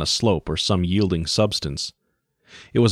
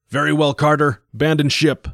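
grim captain's order; 8 semitones higher than usual. Acted. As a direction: give the fatal order. Captain orders abandon ship.

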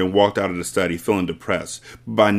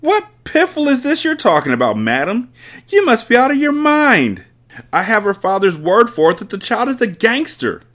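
higher, louder tyrant in full outrage; outraged headmistress; 14 semitones higher, 5 dB louder than usual. Acted. Headmistress scoffing at Miss Honey, high and loud contempt. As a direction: blast her with contempt.

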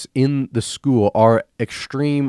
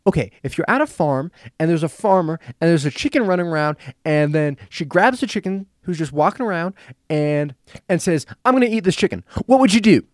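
chicken lady; 6 semitones higher than usual. Retold or imitated. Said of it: "and she said".

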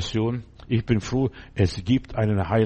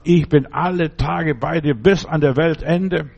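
louder, urging the congregation on; fervent preaching; 2 semitones higher, 4 dB louder than usual. Acted. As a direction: preach it with growing fire. Preaching with rising force.

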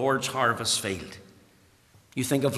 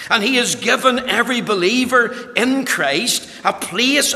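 raised, ringing pulpit voice; preaching at full force; 6 semitones higher, 5 dB louder than usual. Acted. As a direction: preach it out loud and high. Opening of sermon, full force.

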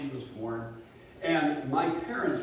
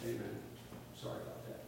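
mumbled offhand apology; 5 semitones lower, 17 dB quieter, faster than usual. Retold or imitated.

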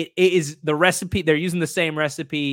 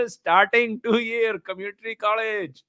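high, loud, slow mock Indian accent announcing a player; hammed-up Indian accent sportscaster intro, drawn out; booming mock Indian accent, like a stadium announcer calling out a new recruit; 8 semitones higher, 5 dB louder, slower than usual. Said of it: "to talk in that accent".

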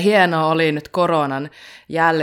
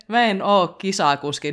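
ordinary talk, nothing marked

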